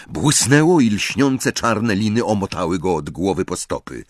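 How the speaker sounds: usual speaking voice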